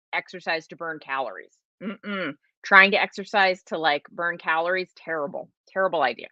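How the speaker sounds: ordinary talk, nothing marked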